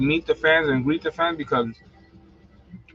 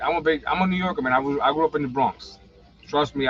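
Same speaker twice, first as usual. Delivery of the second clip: ordinary talk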